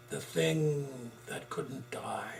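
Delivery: slow, eerie whispered title; spooky whisper. Acted. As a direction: whisper it like a ghost story. Spooky horror title read.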